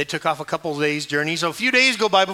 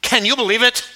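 irritated, worried mom; 5 semitones higher, 8 dB louder than usual. Acted. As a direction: mimic a mom's indignant fretting. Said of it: "irritated and worried voice".

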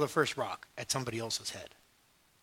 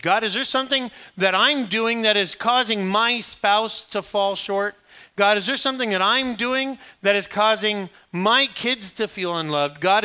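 slow then rising, louder pleading prayer; earnest prayer; 5 semitones higher, 6 dB louder than usual. Acted. Congregant praying to God for change, earnest. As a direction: pray it with growing urgency.

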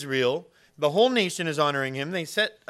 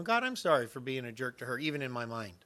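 contrite prayer; 5 semitones lower, 5 dB quieter than usual. Retold or imitated. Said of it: "I had to ask the Lord to forgive me".

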